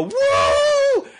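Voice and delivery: very high imitated whoop; excited cheering. Girls taking cell phone pictures, excited cheering. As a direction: whoop like a thrilled fangirl. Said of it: "like, cheering".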